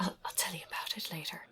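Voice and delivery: quick whisper into the ear. Acted. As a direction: hush it fast, secretive. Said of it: "whisper into Adrian's ear".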